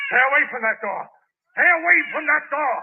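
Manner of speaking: high, pleading then loud command; guard the door in panic; desperate. Acted. Tenant stopping the policeman at the bedroom door, desperate.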